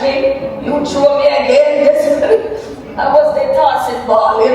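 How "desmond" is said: ordinary delivery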